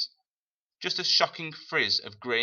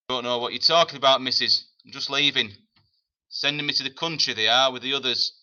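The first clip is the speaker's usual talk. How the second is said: higher, fast, cheeky London lad; a cocky evacuee boy lying to her face; defiant boy; 4 semitones higher, 5 dB louder, faster than usual. Acted. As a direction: brush her off like a streetwise kid. Defiant boy talks back.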